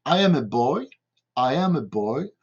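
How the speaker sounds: careful English teaching example, said twice; pronunciation demo. Shown as a demonstration. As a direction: model I AM A BOY for learners. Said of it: "capable de prononcer cette phrase".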